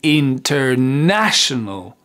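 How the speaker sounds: drawn-out "International", still stressing "na"; very slow, stressed. Shown as a demonstration. Teacher showing stress works slowly, very slow delivery. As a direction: stretch every syllable, lean on "na". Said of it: "On peut le dire très lentement".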